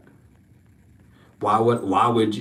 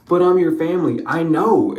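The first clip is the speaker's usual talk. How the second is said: pleading ex-partner; 6 semitones higher, 5 dB louder than usual. Acted. Pleading voiced other person.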